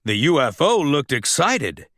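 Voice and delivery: ordinary delivery, nothing marked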